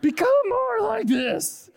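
high, loud, drawn-out proclamation; strained shout. Acted. Jesus holding up a kid to disciples, straining shout. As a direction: strain it out slowly while hoisting a child. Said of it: "Jesus is like".

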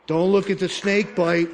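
usual speaking voice

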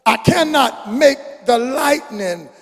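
rising, soaring preacher chant of the lyrics; preaching a song. Acted. Preacher reciting a song to the congregation, soaring chant. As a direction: build it up, let it swell.

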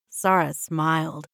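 ordinary delivery

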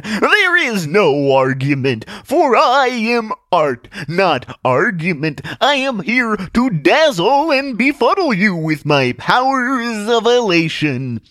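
loud, slow, high theatrical proclamation; hammy stage conjurer in full flourish; grandiose magician. Acted. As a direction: dazzle the crowd with every stretched word. Magician addresses audience.

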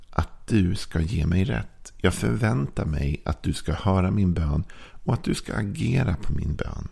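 ordinary talk

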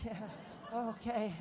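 lukewarm response. Retold or imitated. Preacher imitating an unconvinced congregation, quiet and half-hearted. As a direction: imitate an unconvinced crowd under your breath.

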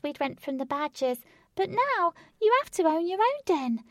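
high chatty rabbit voice. Acted. James the rabbit chats.

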